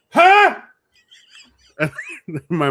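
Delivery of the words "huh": shouted, much higher, much louder hard-of-hearing huh. Retold or imitated. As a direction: yell it like a man who can't hear a thing. Client not hearing, retold.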